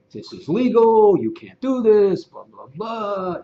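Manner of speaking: loud, high, drawn-out mock of a pushy agent. Strong agent down the speaker's throat, mocking pushy voice. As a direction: imitate an agent hammering a point, stretching the words. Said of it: "really strong agents that are really down my throat".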